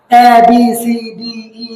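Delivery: "এ" as a pure vowel, no i-glide; childhood-style letter names. Shown as a demonstration. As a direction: recite "এ, বি, সি, ডি, ই" as learnt in childhood. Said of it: "ছোটবেলা অনেকেই পড়ছো".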